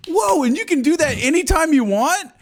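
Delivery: high-pitched mock astonishment; imitating amazed onlookers. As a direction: gush like a wowed crowd, high voice.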